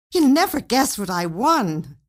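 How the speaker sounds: can hardly contain the news; wife bursting with excitement; excited. A narrator's reading.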